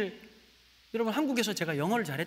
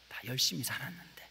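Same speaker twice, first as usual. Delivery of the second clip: whispered despair; 5 semitones lower, 6 dB quieter than usual. Real, not acted. Recalling depression.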